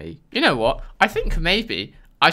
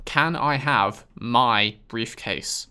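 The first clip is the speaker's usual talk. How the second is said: slow, over-clear question for the robot; slow careful request; 3 semitones lower, much slower than usual. Shown as a demonstration. Player speaking slowly to voice recognition. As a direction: say each word slowly so it understands. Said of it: "issue with the word where".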